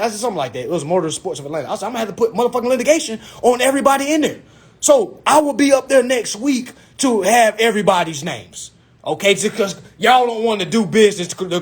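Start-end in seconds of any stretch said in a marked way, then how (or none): 1.9-11.6 hot-headed. Retold or imitated. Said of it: "in my hot head stage".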